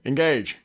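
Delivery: "engage" as en-GAGE; stress on second syllable. Retold or imitated. Host imitating Picard's command, stress on second syllable. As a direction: lean on GAGE in "engage", like a captain's order. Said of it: "Picard puts more emphasis on the second syllable".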